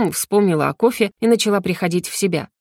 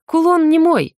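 emphatic; 8 semitones higher, 4 dB louder than usual. A narrator's reading. Shopkeeper springs condition.

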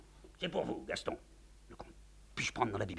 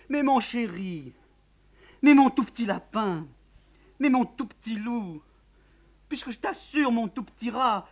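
slow pleading endearments, higher; cooing; 11 dB louder than usual. Acted. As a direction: coax a lover down the phone. Count coos on the phone.

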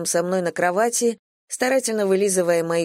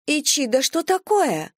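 high, indignant protest; protest, offended and high; indignant girl; 8 semitones higher than usual. A narrator's reading. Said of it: "возмутилась девушка". (возмущённо, высоким голосом)

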